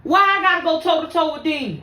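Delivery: luid en verontwaardigd; exasperated outcry. Real, not acted. Exasperated rant, loud.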